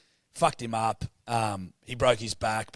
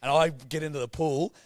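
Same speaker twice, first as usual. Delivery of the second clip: ordinary talk, nothing marked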